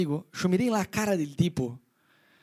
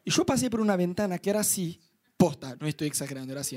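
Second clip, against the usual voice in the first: normal talking voice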